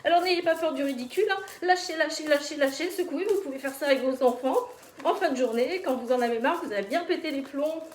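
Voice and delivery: loud, fast, high pep talk; energetic coaching. Real, not acted. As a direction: fire everyone up to let go. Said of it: "energetic coaching during shaking exercise".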